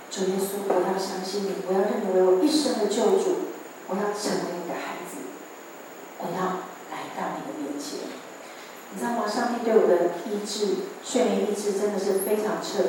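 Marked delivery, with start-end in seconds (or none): 0.1-8.0 softer and softer, fading to a hush; soft prayer. Retold or imitated. Committing her life to Jesus, soft fading prayer. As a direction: pray it quietly with full surrender. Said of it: "告诉上帝说".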